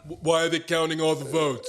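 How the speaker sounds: mock Trump accent. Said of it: "Whose accent, whose accent was that?".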